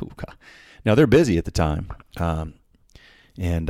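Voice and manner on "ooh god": whispered ooh god. Retold or imitated. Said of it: "I'm like".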